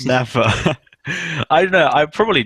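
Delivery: giggling through the words; laughing. Real, not acted. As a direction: laugh it out, high and loud. Laughing after an absurd question.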